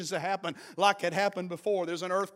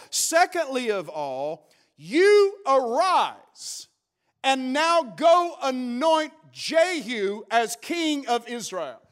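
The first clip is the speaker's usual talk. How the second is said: slow, drawn-out solemn command; God commanding; 4 semitones higher, 4 dB louder, much slower than usual. Acted. God commands Elijah, slow and solemn. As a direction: lay down each word slowly, like a decree.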